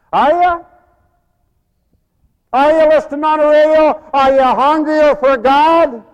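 high, loud challenge from the pulpit; full-force preaching. Real, not acted. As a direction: shout the questions at the congregation.

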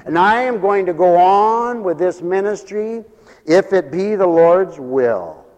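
loud, high, ringing declaration; full-force preaching. Real, not acted. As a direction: preach it out forcefully to the whole congregation.

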